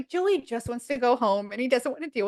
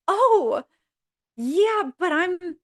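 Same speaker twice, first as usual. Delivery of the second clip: higher, louder mock "oh yeah"; mock surprise; 5 semitones higher, 4 dB louder, much slower than usual. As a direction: fake surprise, pitched up. Monica's quoted exclamation.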